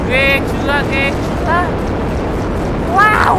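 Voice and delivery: loud, high exclamations rising to a shouted wow; excited amazement. Real, not acted. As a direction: build up to a big, high shout of awe. Gamer marvels at monster's attack. (trầm trồ to, giọng cao phấn khích)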